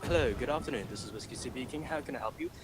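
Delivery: polite British phone greeting; London accent. Retold or imitated. Supervisor picking up a customer's call, London accent. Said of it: "changed the location of my accent from London".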